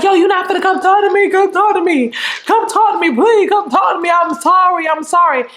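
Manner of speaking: high-pitched, hurried mock pleading; irate pleading. Boyfriend begging his girl to talk at her job, mock irate pleading. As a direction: imitate an agitated man begging to talk. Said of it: "he's getting, like, a little irate".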